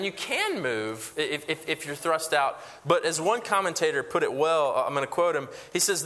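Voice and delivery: ordinary delivery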